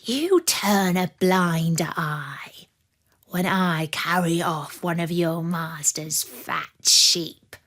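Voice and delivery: the wolf's scheming whisper, slow at first. Acted. The wolf whispering his plan to the sad dog, conspiratorial. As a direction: lay out the secret plan in a hushed whisper.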